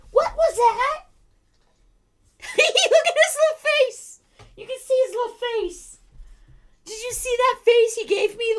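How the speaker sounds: high squealing laughing voice. Real, not acted. Laughing at the dog.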